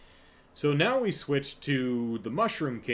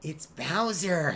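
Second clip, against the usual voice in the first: slow, higher-pitched creepy henchman affect; lightly mimicked sinister sidekick, pitched up; Peter Lorre; 5 semitones higher, slower than usual. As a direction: draw it out like an old-movie lackey. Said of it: "kind of a Peter Lorre".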